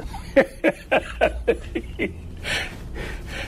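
long breathy chuckle; amused, wheezy run of chuckles; chuckling laugh. Real, not acted. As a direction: laugh softly at the memory. Laughs at his own retold quote.

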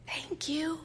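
soft, high, hushed thanks; whisper. A narrator's reading. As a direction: thank him barely above a whisper. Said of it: "I think I whisper".

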